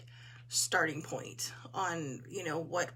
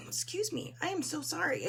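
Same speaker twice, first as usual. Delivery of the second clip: hoarse voice; 8 semitones higher than usual. Real, not acted. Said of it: "I do not know what is wrong with my voice".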